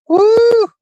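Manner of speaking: sudden high, loud woo; mock gas whoop. Streamer pretending to get gassed on the highway, high loud whoop. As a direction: yelp as if hit by a cloud of gas.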